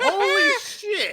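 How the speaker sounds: high, slow laughing outburst; laughing exclamation. Real, not acted. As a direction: burst out laughing mid-curse. Laughing shock at new character.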